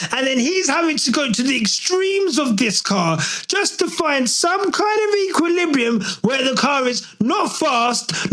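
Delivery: normal talking voice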